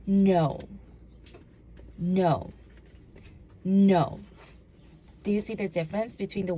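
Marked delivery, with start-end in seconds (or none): 0.1-0.6 loud, firm refusal. Shown as a demonstration. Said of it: "Say it as I say it". 2.0-2.5 firm no. Shown as a demonstration. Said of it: "Say it as I say it". 3.6-4.2 loud, final no; firm no. Shown as a demonstration. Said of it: "Say it as I say it".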